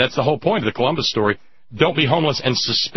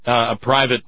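ordinary talk, nothing marked

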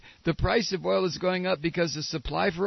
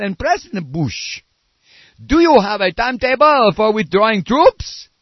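hammed-up French accent, louder and higher; bad French accent; 5 semitones higher, 10 dB louder than usual. Comedian mocking a French reporter's question in a bad French accent. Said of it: "This is the worst French accent".

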